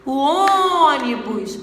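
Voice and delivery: "ônibus" slow, with Ô held long; lengthened first-syllable stress. Shown as a demonstration. Teacher pointing out the tonic syllable, "ônibus" with stretched Ô. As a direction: draw out the ô of "ônibus". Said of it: "O on, que é a sílaba tônica".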